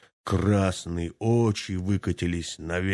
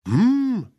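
loud high hammed-up bear roar; mock bear roaring back; roaring hum; 6 dB louder than usual. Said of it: "заревел медведь".

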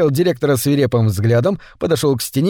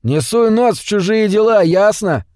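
rude; 7 semitones higher than usual. A narrator's reading. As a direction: shove the stranger off with a rude threat. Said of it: "грубо сказал Тримбл".

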